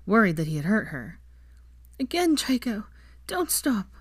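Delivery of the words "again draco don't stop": high, urgent cry of pleasure; passionate cry. A narrator's reading. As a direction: cry it out, much higher, breathless. Lover cries out in passion.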